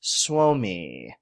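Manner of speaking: usual speaking voice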